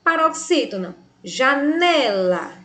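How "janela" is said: "janela" drawn out slowly on "ne"; stress on penultimate syllable. Shown as a demonstration. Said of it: "Sílaba tônica, a penúltima". ("janela" devagar, alongando a sílaba tônica "ne")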